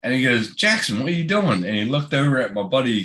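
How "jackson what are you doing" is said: high-pitched mock principal; exasperated principal impression; retold principal's question. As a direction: imitate a principal calling out a pupil, pitched up. Retold principal addressing pupil.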